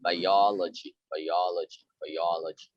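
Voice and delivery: biology with a strong stress on the second syllable, -OL-; teacher drilling the stress. Shown as a demonstration. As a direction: lean on the -ol- in biology. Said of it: "stress the second syllable".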